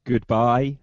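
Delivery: a robotic goodbye, on request. Pretend. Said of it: "can he speak with a robot voice".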